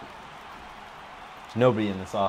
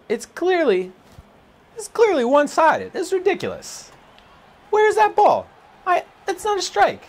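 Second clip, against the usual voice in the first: rising, louder protest at the umpire; incredulous; 4 dB louder than usual. Real, not acted. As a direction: voice climbing much higher. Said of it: "my voice is going up 'cause I'm incredulous".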